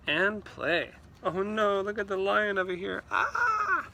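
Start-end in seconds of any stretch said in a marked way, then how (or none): 1.2-3.9 mock alarm.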